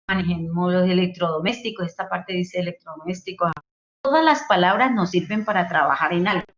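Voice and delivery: ordinary delivery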